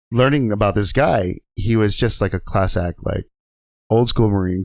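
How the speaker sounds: ordinary delivery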